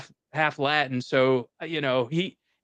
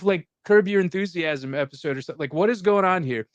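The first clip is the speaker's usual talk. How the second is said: normal talking voice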